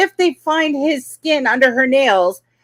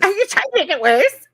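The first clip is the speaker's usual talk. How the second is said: mock yell; 8 semitones higher than usual. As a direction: mimic her yelling at him, half-hearted. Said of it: "She yells at him".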